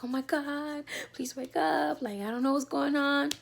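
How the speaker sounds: high, strained retold panic; panicked plea. Begging her silent newborn to wake, panicked. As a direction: beg the baby to wake up.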